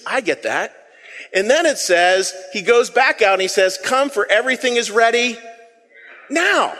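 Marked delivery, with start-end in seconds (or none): 3.8-6.7 now rising sharply high; emphatic urgent call. Shown as a demonstration. Pastor urging the congregation with a rising, urgent now. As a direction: stress the word now, pitched up. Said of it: "Imagine that being the wedding invitation".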